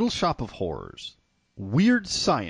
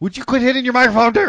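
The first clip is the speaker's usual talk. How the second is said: mock nagging spouse; 12 semitones higher, 7 dB louder, faster than usual. Scolding co-host playfully.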